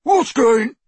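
high, loud mocking copy; mocking mimic. A narrator's reading. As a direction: mimic the call to taunt. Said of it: "mimicked Crabbe".

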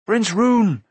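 high, loud cry of a name. A narrator's reading. Searcher to missing prince in the dark, crying out. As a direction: call urgently to a lost companion. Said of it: "Taran cried".

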